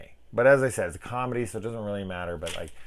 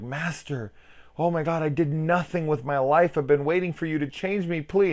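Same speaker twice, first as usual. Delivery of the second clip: higher, pleading, whiny voice; begging loser; 10 semitones higher, 5 dB louder than usual. Retold or imitated. Pathetic guy begging the vampire master to turn him, whiny and pleading. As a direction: beg the master desperately. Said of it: "the guy's like".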